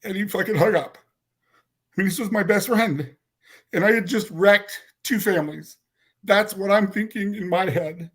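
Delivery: high, strained voice near tears; choked up. Real, not acted. Teller confessing he wrecked two families, choked up. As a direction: push on through real upset. Teller overcome, recalling betrayed best friend.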